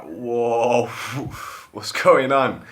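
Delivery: loud, high drawn-out whoa; acted alarm. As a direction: call out over a plunging dive. Retold reaction to nosedive.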